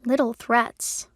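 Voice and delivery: slow, indignant echo; incredulous. A narrator's reading. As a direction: repeat it in disbelief. Incredulous echo of his words.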